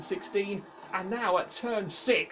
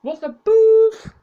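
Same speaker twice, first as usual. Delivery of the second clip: exasperated shout; 14 semitones higher, 15 dB louder than usual. Real, not acted. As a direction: shout in disbelief. Frustrated outburst.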